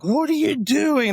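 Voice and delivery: high, loud, drawn-out cry of disbelief; retold outraged yell. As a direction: yell it at whoever just hurt you. Retold outburst at the nurse.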